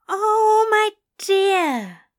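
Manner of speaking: high, loud, gushing endearment; touched exclamation. Acted. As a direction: coo it loudly, deeply touched. Angel touched by demon's confession.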